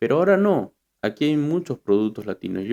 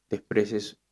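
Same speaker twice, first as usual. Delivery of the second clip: ordinary delivery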